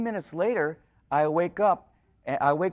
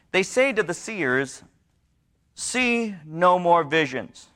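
slow, louder preached reading of the verses; scripture reading; 3 semitones higher, 5 dB louder, slower than usual. Acted. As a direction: read the words as a solemn charge. Reading Isaiah aloud, slowly.

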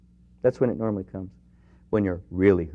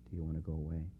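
soft whisper; 5 semitones lower, 15 dB quieter, faster than usual. Acted. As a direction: lower and much softer, near a whisper. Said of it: "whisper to you and say".

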